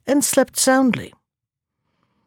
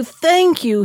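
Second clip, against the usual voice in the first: thanks cried higher; joyful cry; 7 semitones higher than usual. Acted. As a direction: lift it up with joy. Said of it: "he cried".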